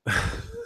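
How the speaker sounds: a sudden squeaky laugh; high burst of laughter. Real, not acted. Laughs at the parting words.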